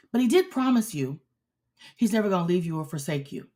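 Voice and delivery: normal talking voice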